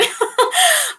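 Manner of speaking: high bright laugh at herself; real burst of laughter. Real, not acted. Laughing at her own joke, a high laugh.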